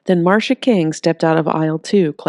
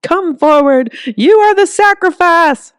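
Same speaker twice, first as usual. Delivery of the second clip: much higher, louder, slow proclamation; fanatical proclamation; 13 semitones higher, 8 dB louder, slower than usual. Acted. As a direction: pronounce judgment like a prophet. Zealot condemns boy.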